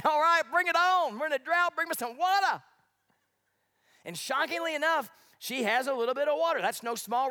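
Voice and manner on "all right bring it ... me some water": high, louder cocky Elijah; eager Elijah. Retold or imitated. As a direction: bring it on, Elijah-style. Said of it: "He's thinking".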